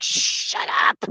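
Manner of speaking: hissed, voiceless shut-up; harsh hushing. Acted. Buddy silencing his loud friend, hissed and harsh. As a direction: hush him sharply without using your voice.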